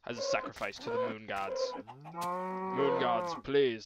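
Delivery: sudden high, loud invocation to the moon gods; hammed-up offering to the moon gods, loud; mock ritual cry.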